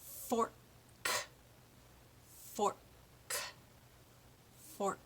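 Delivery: FORK with the K released apart, each time; slow drill, pop the k ending each fork; clear final K. Shown as a demonstration. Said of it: "we'll do that word three times".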